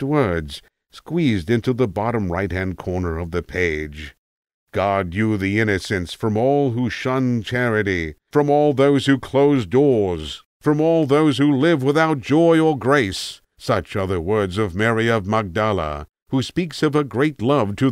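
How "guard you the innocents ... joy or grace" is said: solemn scripture reading. Acted. Reading old scripture aloud.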